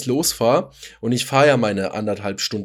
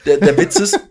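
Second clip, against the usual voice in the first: overcaffeinated excitement; 6 semitones higher, 8 dB louder than usual. Real, not acted. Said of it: "überkoffiniert".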